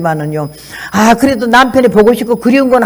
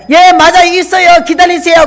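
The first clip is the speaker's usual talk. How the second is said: higher, louder door call; loud answer; 8 semitones higher, 4 dB louder than usual. Retold or imitated. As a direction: shout across the doorway. Answering door too loudly. (문 앞 손님에게 큰 소리로 답하기)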